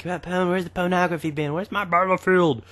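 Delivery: high-pitched mock demanding fan; put-on high voice of a nagging viewer; impatient viewer. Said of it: "in case you're like".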